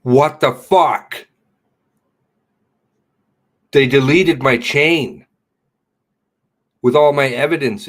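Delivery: real loud fury at deleted tweets; furious outburst. Real, not acted. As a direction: yell it out in outrage. Discovers chain deleted.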